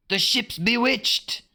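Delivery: high, fearful pirate cry; a frightened pirate calls it out; spooked sailor wailing. A narrator's reading. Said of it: "another pirate cried".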